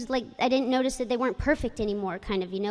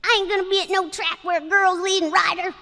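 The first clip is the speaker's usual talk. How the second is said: imitated Kentucky jockey, pitched up; Kentucky accent; 7 semitones higher, 4 dB louder than usual. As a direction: put on a higher Kentucky twang. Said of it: "really kind of a cute Kentucky accent".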